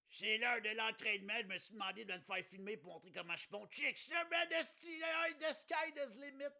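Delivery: high loud boastful jock; cocky athlete voice. Pretend. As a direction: shout it like a cocky show-off. Acting pole vaulter character.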